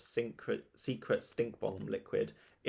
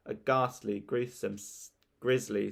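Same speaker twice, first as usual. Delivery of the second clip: usual speaking voice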